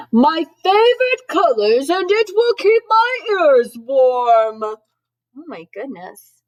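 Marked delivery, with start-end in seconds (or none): 0.1-4.6 high, booming, drawn-out Santa voice; loud, slow, delighted holler; really loud Santa. A narrator's reading. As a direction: shout it as if your ears are covered. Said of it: "He said that really loud".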